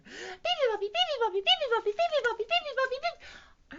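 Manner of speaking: very high, loud birdsong chatter; squeaky rapid-fire chirping; chirping little bird. A narrator's reading. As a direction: pipe it out like a tiny tireless bird. Bird's noisy song in the story.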